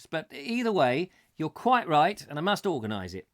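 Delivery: ordinary talk, nothing marked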